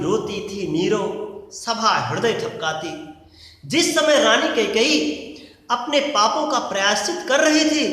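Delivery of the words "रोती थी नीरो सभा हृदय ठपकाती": slow, hushed recited verse line; solemn slow reading of the line; poem recitation. Shown as a demonstration. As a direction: intone the verse slowly like poetry. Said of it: "recited verse of the poem".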